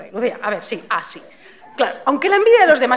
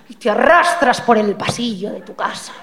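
usual speaking voice

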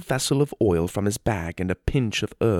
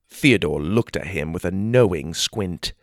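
ordinary delivery

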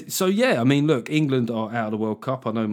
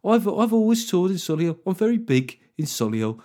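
put-on a light Midlands Solihull accent, higher; 6 semitones higher than usual. Comic bragging to listeners in a light Solihull accent. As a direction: brag in a mock Solihull accent. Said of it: "I changed to a Solihull podca-, accent".

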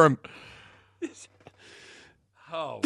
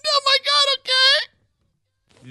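laughing; much slower than usual. Real, not acted. Laughing reaction to clip.

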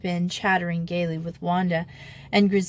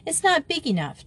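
higher-pitched flat-out objection; firm declaration; 9 semitones higher than usual. A narrator's reading. Margia objecting to Amy's river, firmly declaring. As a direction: declare it with certainty. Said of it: "declared Margia".

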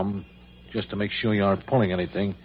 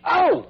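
sharp high yelp of pain at the needle; 4 dB louder than usual. Acted. Gunman yelping as the doctor jabs him. Injection while held at gunpoint.

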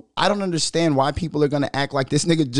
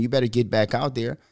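ordinary talk

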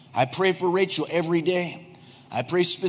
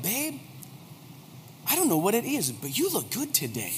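much softer, an intimate aside to his wife; soft tender compliment; 3 semitones higher, 8 dB quieter, faster than usual. Shown as a demonstration. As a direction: say it gently, like only she should hear. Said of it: "try one of these".

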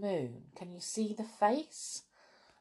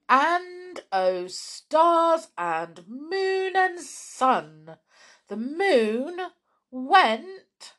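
rising higher and louder to a loud pop; building excitement; 11 semitones higher, 8 dB louder, much slower than usual. A narrator's reading. Storyteller to children, building up to the balloon popping. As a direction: narrate it building to a big burst.

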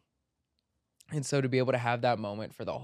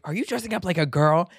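raised, faster accusing question; mother's outraged demand, quicker; angry mother; 6 semitones higher, 6 dB louder, faster than usual. Retold or imitated. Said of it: "angry Egyptian woman screaming at me".

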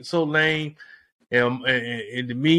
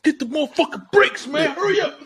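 panicked; 9 semitones higher than usual. Retold or imitated. Retold panicked reaction to livestream.

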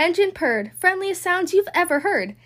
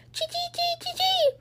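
very high, soft squirrel chatter; tiny high squirrel chirps; 6 dB quieter than usual. Acted. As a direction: chitter it quickly like a squirrel. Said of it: "The gray squirrel chattered".

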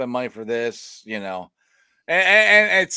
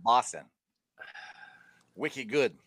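understated, lower Boston accent; 4 semitones lower, 4 dB quieter, slower than usual. Shown as a demonstration. Trying out Boston for his co-hosts, understated accent. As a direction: toss it off in Boston dialect. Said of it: "you said Boston accent?".

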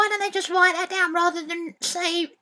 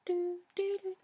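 barely audible; 11 dB quieter than usual. Acted. Boy humming as quietly as dad asked, barely audible. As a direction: hum it almost silently. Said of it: "Bit quieter, George".

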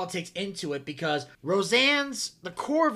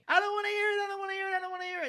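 put-on high-pitched panicked kid protest; rapid-fire, high mock scream; 10 semitones higher, much faster than usual. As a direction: imitate a kid screaming as he runs.

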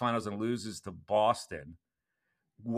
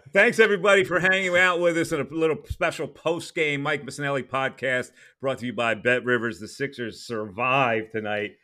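announcer outro; 4 semitones higher, 5 dB louder than usual. Acted. Podcast outro with sponsor.

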